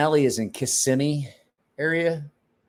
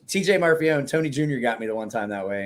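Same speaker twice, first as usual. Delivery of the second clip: ordinary talk, nothing marked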